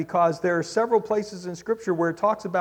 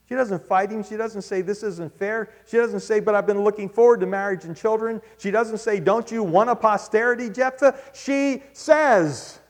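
high, loud preaching cadence; preaching at full force; 7 semitones higher, 5 dB louder than usual. Acted. Preacher listing the daughter's silences, high and emphatic. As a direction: drive each line home.

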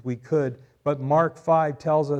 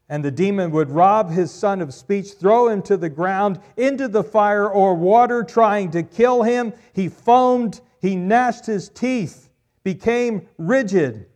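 loud rising preacher's build, slowing on the last lines; preaching at full force; 6 semitones higher, 9 dB louder than usual. Acted. Preacher to congregation, describing the demon, loud build. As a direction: drive home the horror from the pulpit.

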